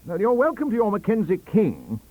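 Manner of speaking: loud, high dismissive shout. Acted. Careless shouting at Stacey, loud. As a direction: wave King away loudly. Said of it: "shouted Professor Careless".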